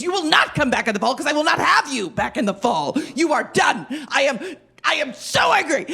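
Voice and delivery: high, loud, shouting fury; furious boss. Retold or imitated. Boss firing her over the phone, loud and high. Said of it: "he was so mad".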